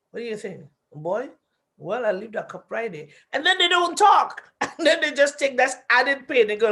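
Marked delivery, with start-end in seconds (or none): 0.1-3.1 put-on husband grumbling back at wife who called him boy, quietly; quiet sulky grumble. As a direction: mutter it back, wounded pride. Husband resents being called boy.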